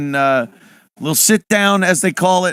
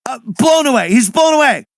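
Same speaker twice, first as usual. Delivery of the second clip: mock excitement; 6 semitones higher than usual. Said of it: "everyone's like".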